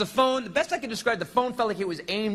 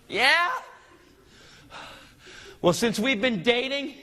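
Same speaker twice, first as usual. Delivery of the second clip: mock gotcha jab; 7 semitones higher, slower than usual. Husband landing his last insult on his wife, high and drawn out. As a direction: land the killer insult slowly.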